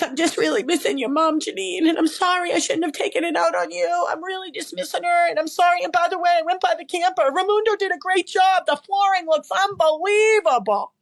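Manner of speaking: high, mock-weepy voice throughout; imitating crying dad. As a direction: act out an emotional father choking up on the phone. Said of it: "and he's crying".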